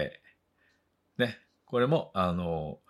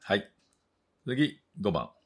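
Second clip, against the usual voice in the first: ordinary talk, nothing marked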